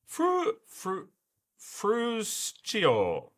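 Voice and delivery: high, halting "Fru-, fru-, fruscio" with a CH in the ending; testing new pronunciation. Shown as a demonstration. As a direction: stammer towards "fruscio", ending it in "cho". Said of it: "The C O is pronounced cho".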